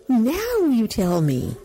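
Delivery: high, slow, drawn-out exasperation; exasperated aside to oneself; exasperated inner voice. Retold or imitated. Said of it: "And I think to myself".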